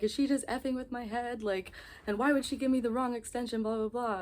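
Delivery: high, upset disbelief; freaking out. Retold or imitated. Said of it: "I was freaking out earlier".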